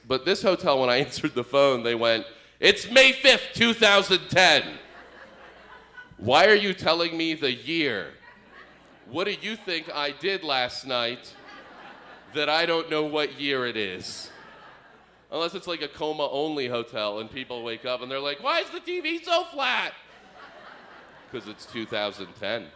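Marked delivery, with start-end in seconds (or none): 2.6-4.8 much higher, louder chirpy announcement, slowing on the year; hotel wake-up call. Retold or imitated. Hotel staff on the wake-up call, chirpy and loud. 18.4-20.0 very high, bewildered squeak of a question; confused waker. Pretend. Coma hotel guest waking up, baffled high squeak.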